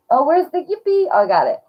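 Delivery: high and loud, very excited; excited squeal. Real, not acted. Hunting for the yippee picture.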